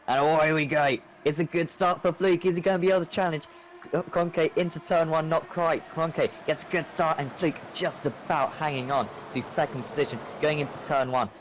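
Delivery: rising, fast live commentary; excited race call. Real, not acted. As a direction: call the start with energy.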